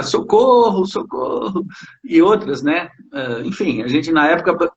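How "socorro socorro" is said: high-pitched playful plea; mock cry for help. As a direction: imitate a melodramatic call for rescue. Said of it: "Toda hora". (pedido de socorro de brincadeira, em voz aguda)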